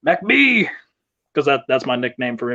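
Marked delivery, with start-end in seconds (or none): 0.1-0.7 imitating a reluctant wrestler, pitched high; high-pitched put-on plea to a buddy; Dion. Wrestler calls buddy by nickname before their match, imitated high plea. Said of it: "Dion looks at me and he goes".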